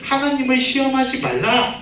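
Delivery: mildly firm retold rebuke; retold scolding. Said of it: "마귀를 다그치면서 혼을 냈어요".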